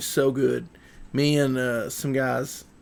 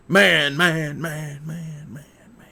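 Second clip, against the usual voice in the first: mock echo. Streamer faking echo on his hero's name, fading to nothing. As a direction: repeat it, fading from full voice to whisper. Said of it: "that's supposed to echo".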